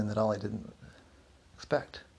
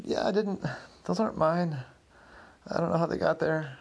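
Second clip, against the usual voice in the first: high, pleading denial; panicked protest; 6 semitones higher than usual. Acted. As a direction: insist it isn't yours, voice climbing. Clinton protesting while being cuffed.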